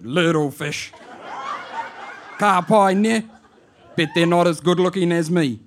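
put-on high, loud Māori auntie calling out to her boy, amused; auntie on the answer phone. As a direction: shout down the phone like a bewildered old auntie. Auntie teasing boy about women.

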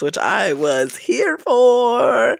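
high, drawn-out singsong savouring; slow, high-pitched relish; gleeful relish. Real, not acted. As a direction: stretch it out high with gossipy delight. Gleeful singsong aside to audience.

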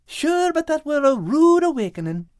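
put-on high, earnest young voice. A narrator's reading. Al complains about his rough wake-up, high and rueful. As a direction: grumble it good-naturedly.

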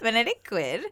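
put-on valley-girl upspeak through a laugh. Co-host copying the brand name, valley girl.

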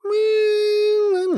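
very high, stretched squeal; high drawn-out hedging. Real, not acted. Asked how many podcasts, stalling in a long high squeal. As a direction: hedge on one long high note.

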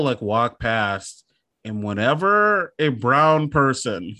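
ordinary talk

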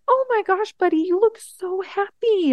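high, bright mock gush at her happy son; excited mom. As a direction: gush over him like a thrilled mom. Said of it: "And I was like".

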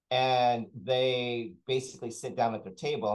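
usual speaking voice

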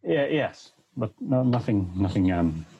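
hollow war-weary soldier. Acted. Shell-shocked soldier denies trauma.

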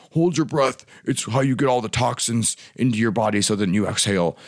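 put-on motivational-guru voice; Tony Robbins. Podcast coach mimics a guru's breathing advice, put-on impression voice. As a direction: step out of your own voice into an impression of a famous coach. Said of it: "that was my Tony Robbins".

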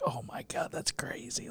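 very soft, whispery awe; hushed amazement. Retold or imitated. Grown uncle marvelling at how roles change, in a whisper. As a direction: breathe it out in retold wonder.

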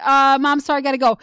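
loud, imitated brush-off; mock hurried excuse to Mom; kids dodging Mom. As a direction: cut Mom off to get off the phone. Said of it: "like, they're like".

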